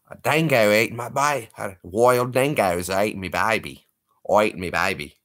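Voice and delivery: mock Aussie line, hammed up; Aussie accent. Shown as a demonstration. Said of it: "Say dingo".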